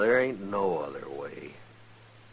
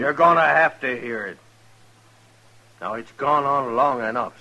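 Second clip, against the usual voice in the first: raised voice, standing his ground; firm loud challenge to the brother; loud defiance; 6 semitones higher, 9 dB louder than usual. Acted. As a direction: push back hard and loud. Suitor confronts angry brother.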